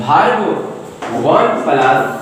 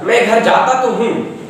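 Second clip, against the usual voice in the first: मैं घर जाता "तो" हूँ, with तो pushed out; stress on तो; 6 semitones higher than usual. Shown as a demonstration. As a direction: hit the word तो. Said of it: "जोर देके बोलिए जरा".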